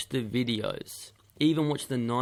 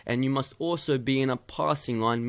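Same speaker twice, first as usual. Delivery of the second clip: ordinary talk, nothing marked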